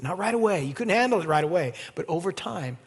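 ordinary talk, nothing marked

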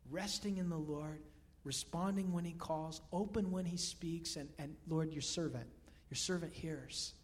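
ordinary talk

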